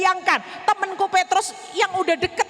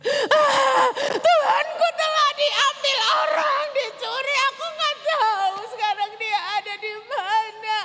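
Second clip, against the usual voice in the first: high, slow sobbing lament; high slow tearful wail; weeping; 9 semitones higher, slower than usual. Acted. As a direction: cry it out through tears. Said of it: "Aku menangis terseduh-seduh".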